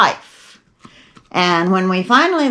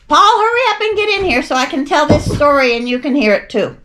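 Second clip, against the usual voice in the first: higher-pitched call to someone approaching; 7 semitones higher than usual. Real, not acted. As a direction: call him over to come and listen. Calling Paul over to listen.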